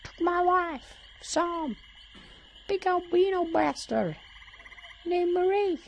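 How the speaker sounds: very high, squeaky puppet voice; Elmo. Acted. Gator hunter recounting losses, in a squeaky Muppet voice. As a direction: squeal it in short Muppet phrases. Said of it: "Elmo is still on the hunt".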